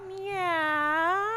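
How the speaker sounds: high stretched meow; cat meow. Shown as a demonstration. Teacher leads kids through cat pose, high meow. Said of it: "For cat, you're gonna go".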